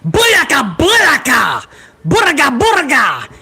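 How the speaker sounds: punchy sing-out call; catchphrase call. Acted. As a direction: whoop the catchphrase like a hype man. Calls out entrance catchphrase.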